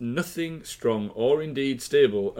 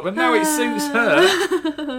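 laughing; 13 semitones higher, 5 dB louder, much slower than usual. Real, not acted. Mocking Theresa May's U-turn.

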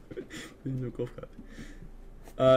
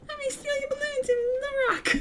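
put-on high, creepy little kid voice; creepy balloon kid voice. Acting out balloon kid character.

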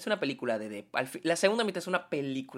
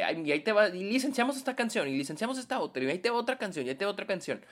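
mocking voice; 6 semitones higher, faster than usual. Mocking song-licensing filmmakers.

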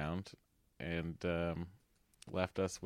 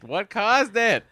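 surprised; 13 semitones higher, 9 dB louder, slower than usual. Real, not acted. Incredulous question.

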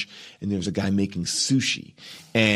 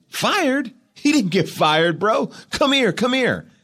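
incredulous director; slower than usual. Retold or imitated. Director waving off the firing in high disbelief. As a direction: act like the firing never happened. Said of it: "He's like".

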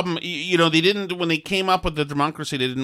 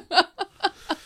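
real burst of laughter, pitched up high. Real, not acted. Laughing at the girl's comment.

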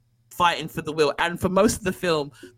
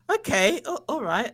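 higher-pitched, amused mock bewilderment; hesitant high-pitched going along with it; taken aback; 7 semitones higher than usual. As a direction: play at being thrown by a sudden turn. Acts out awkward surprised film reaction.

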